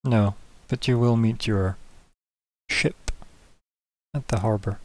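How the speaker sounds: lightly voiced governor, slow and soft; governor character. Governor arranges the ship at harbor, quietly measured. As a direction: correct them quietly and slowly.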